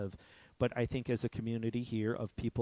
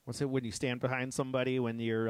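normal talking voice

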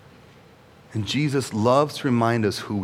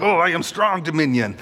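mock strongman; 4 semitones higher than usual. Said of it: "that's not like".

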